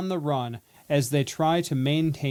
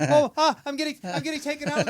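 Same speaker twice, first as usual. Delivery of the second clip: mock panic; 11 semitones higher, 3 dB louder, faster than usual. Mock panic being abducted.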